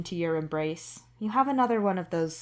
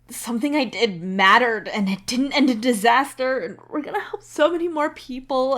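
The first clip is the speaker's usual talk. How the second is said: louder and higher, words spilling out near tears; tearful outpouring; 6 semitones higher, 8 dB louder than usual. Acted. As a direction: let it overflow, barely holding back tears. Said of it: "a teary-eyed mess".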